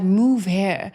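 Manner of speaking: ordinary talk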